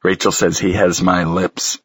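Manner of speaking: plain reading voice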